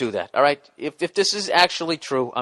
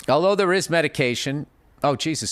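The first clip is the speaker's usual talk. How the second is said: usual speaking voice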